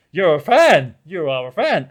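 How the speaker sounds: hammed-up, emphatic realization; excited recognition. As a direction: act out delightedly spotting a fellow fan. Acts out recognizing a fellow fan.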